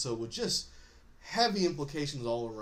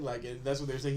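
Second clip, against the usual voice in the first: ordinary delivery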